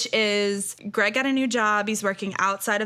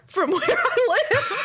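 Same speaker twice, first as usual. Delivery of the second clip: laughing. Real, not acted. Laughing at getting lost.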